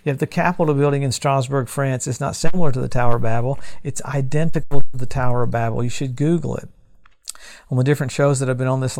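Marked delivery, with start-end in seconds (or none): 2.1-5.8 "similar" and "identical" hit hard and loud; the preacher loudly stresses "similar" then "identical"; emphatic contrast. Real, not acted. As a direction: correct himself loudly, "not similar", "identical".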